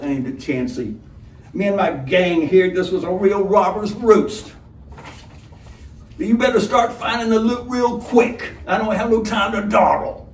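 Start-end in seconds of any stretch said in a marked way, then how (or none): 1.5-10.3 lightly voiced menacing robber; a hint of a gruff robber, easy threat.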